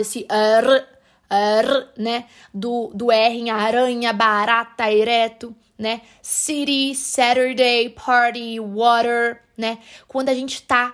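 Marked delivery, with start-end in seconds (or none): 0.3-1.9 "R" as a trilled tongue sound; rolled R sound. Shown as a demonstration. Teacher isolating the little sound, rolled R. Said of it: "esse sonzinho enrolado". 6.2-9.4 "City, Saturday, party, water" with T tapped like R; American tap in each of "City, Saturday, party, water"; flapped T as R. Shown as a demonstration. Teacher listing examples of the rolled sound, flapped T. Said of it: "a gente faz esse sonzinho enrolado".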